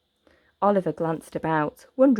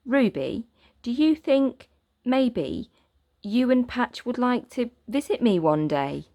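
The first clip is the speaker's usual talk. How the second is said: put-on a touch higher, tentative boy; hopeful boy; 6 semitones higher than usual. Boy shyly inviting the girl to visit. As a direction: shyly ask her to visit. Oliver hopefully asks Ruby.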